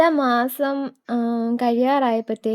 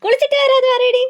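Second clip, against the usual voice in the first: higher, louder, faster coaxing; eager squeal; 13 semitones higher, 5 dB louder, faster than usual. Real, not acted. Mother urging her baby to tell the viewers, excited high squeal.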